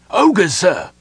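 high, loud warning; cried alarm. A narrator's reading. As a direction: raise the alarm to a commander. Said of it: "he cried".

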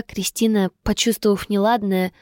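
ordinary delivery

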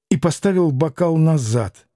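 plain reading voice

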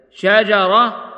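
ordinary talk

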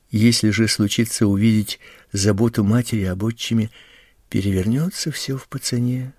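usual speaking voice

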